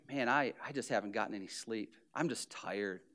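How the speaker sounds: low, soft, put-on weariness; fishing for sympathy. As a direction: sigh it out so they'll ask. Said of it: "just like".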